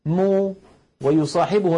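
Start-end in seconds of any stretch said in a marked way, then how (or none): 0.1-0.5 مو with lips rounded; demo rounded vowel. Shown as a demonstration. Teacher shows lip rounding on مو. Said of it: "هكذا".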